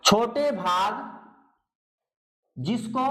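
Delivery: slow dictation with long pauses between words. Shown as a demonstration. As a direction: dictate word by word for writing down. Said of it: "तो आप लिख सकते हो".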